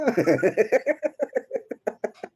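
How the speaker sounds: drawn-out laugh. Real, not acted. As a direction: let a long laugh out. Laughs after kakasad. (mahabang tawa)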